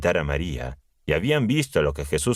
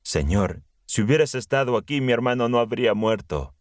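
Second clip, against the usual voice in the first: higher-pitched, mildly pleading narration; pleading at his feet; 7 semitones higher than usual. A narrator's reading. María at Jesús's feet over her dead brother, mildly pleading. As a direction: read it higher, as a restrained plea. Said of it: "se arrojó a sus pies y le dijo".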